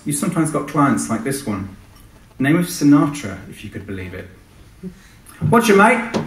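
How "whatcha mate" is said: much higher and louder flashy client shouting; shouty client voice. Acted. Client Sinatra shouts over the helicopter intercom, brash. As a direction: bark down the intercom like a flash boss. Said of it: "A shouter too, this one".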